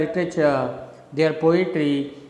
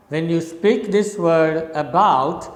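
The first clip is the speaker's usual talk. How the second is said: ordinary talk, nothing marked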